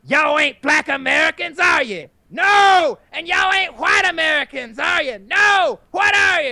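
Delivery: high, loud, slow preacher shout; preacher hyping crowd. Retold or imitated. Imitating the rally minister, loud high preaching shout.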